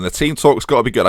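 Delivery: usual speaking voice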